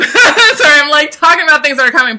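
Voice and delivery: high, loud, laughing through words; laughing apology. Real, not acted. Laughs at herself.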